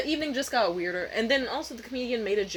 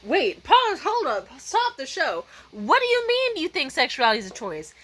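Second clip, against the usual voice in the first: raised, emphatic halt to everything; acted disbelief; 5 semitones higher, 5 dB louder than usual. Comedian challenging an audience member's answer, acted disbelief. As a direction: stop the show in mock astonishment.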